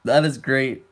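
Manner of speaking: amused, just coming out of a laugh; crying with laughter. Real, not acted. Streamer delighted by friend's voice clip, crying with laughter. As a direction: say it through leftover giggles. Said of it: "I'm actually crying 'cause that was great".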